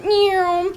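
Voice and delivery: high, loud mock meow for a revving engine. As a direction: imitate the ATV whining up the hill. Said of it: "she revs this thing up".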